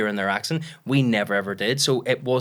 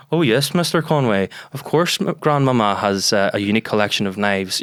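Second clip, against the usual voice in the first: louder mock politician dub voice; Gerry Adams overdub; 5 dB louder than usual. Comic dubs Gomez Addams as the politician, Gerry Adams impression. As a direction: put on a measured Belfast politician's voice. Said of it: "every time Gomez Adams spoke".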